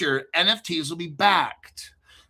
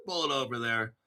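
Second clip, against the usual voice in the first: ordinary talk, nothing marked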